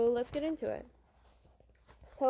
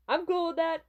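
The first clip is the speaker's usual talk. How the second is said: higher, louder breezy idol; carefree pop star; 7 semitones higher, 5 dB louder than usual. Acted. Pop star brushing off his bodyguard's worry, loud and carefree. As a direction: brush it off brightly, pitched up.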